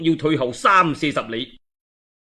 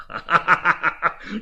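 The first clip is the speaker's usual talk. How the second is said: short scornful laugh; 2 semitones higher, 4 dB quieter than usual. Acted. Cao Cao brushing off Guo Jia's fear of ambush, scornful laugh. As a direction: laugh off the danger with contempt. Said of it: "曹操笑啦".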